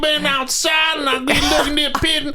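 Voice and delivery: loud, very high Southern hillbilly rant; hammed-up hillbilly holler. Shown as a demonstration. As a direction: ramble shrilly in an exaggerated backwoods Southern accent. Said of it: "just straight up hillbilly".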